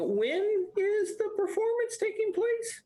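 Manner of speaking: very high, thin-stretched question; nervous squeak. Acted. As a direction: squeak it out, put on the spot. Flustered performer put on the spot.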